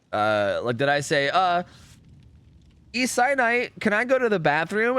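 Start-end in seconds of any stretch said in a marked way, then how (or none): 2.9-5.0 higher-pitched pupil's question; pupil asking. Retold or imitated. Pupil asks teacher for the bathroom, higher-pitched mock child. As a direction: ask innocently, pitched up, as a pupil. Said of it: "And then you were like".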